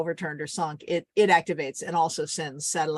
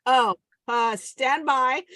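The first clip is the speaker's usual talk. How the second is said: mayday radio call; 4 semitones higher, much slower than usual. Shown as a demonstration. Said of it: "be the Coast Guard".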